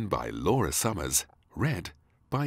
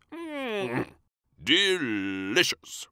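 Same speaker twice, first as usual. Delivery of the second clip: slow, savouring mmm; hammed-up delighted king; 12 semitones higher, 5 dB louder, much slower than usual. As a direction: oversell the taste to the child. King pretending lunch is tasty.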